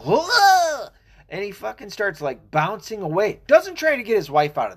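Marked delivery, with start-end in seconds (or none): 0.1-0.9 short, much higher and louder mock yelp; a sudden yelp, half acted; imitated yelp of pain. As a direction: copy the sound the shot man made. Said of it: "you hear him go".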